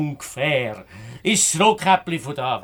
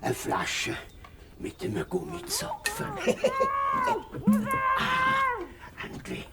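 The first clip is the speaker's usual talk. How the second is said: wolf voice; 10 dB quieter than usual. Acted. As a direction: gloat over the bottle like a greedy wolf. Wolf alone, finds the bottle.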